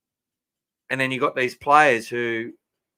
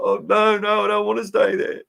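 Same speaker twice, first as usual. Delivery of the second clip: put-on higher, pleading little boy's voice; young son's voice; 10 semitones higher than usual. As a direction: imitate a kid begging not to be left. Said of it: "He's like".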